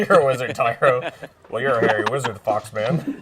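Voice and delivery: loud, laughing tease. Real, not acted. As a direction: giggle through the teasing line. Teasing the player, laughing.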